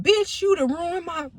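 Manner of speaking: high, mock angry cussing; retold cussing out. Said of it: "he was cussing me out".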